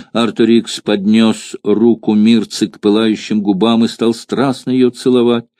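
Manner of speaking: ordinary delivery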